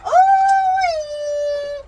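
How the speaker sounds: high, loud drawn-out ooh-wee; delighted whoop. Real, not acted. Mother cheering the toy car down, delighted whoop. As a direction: whoop as if riding down a slide.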